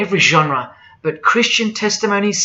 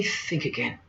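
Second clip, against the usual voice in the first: hushed, voiceless warning; whispered warning; 2 semitones lower, 12 dB quieter than usual. Real, not acted. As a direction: drop to a near whisper. Hushed warning after mimicked objection.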